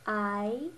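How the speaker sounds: "I" rising like a question; second tone. Shown as a demonstration. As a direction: say "I" with the pitch sweeping up, as if asking. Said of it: "here are the four tones".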